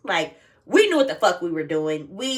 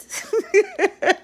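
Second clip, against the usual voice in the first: sudden high bright giggle; 13 semitones higher, 5 dB louder than usual. Real, not acted. Laughing that her mom may already know. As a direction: giggle at the thought.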